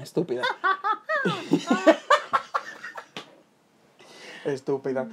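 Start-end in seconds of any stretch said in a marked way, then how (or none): 0.5-3.2 squealing laugh, almost coughing; high wheezing laugh. Real, not acted. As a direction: let a high laugh spill out. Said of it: "yo me reía".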